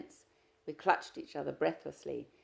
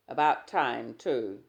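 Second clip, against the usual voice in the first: grudging; 3 semitones lower, 10 dB louder, slower than usual. Acted. Husband grudging.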